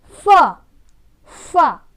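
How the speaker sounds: फा said loud as fa, lip on teeth; loud model f sound. Shown as a demonstration. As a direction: call out फा for the class. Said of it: "क्या पढ़ते हैं".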